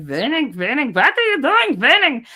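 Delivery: high, swooping put-on accent, W said as V; slipping into character. Shown as a demonstration. Streamer calling Winning back from La La, high character voice. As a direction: scold in a silly high character voice. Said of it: "I slip into different characters".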